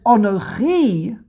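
Anochi stretched out, high and loud; stress on Anochi. Shown as a demonstration. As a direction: hit Anochi hard and hold it. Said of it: "Anochi has an emphasis".

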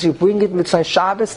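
ordinary talk, nothing marked

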